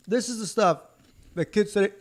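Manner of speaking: chokes on candy mid-sentence; coughing while eating. Real, not acted. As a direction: coughs on a mouthful.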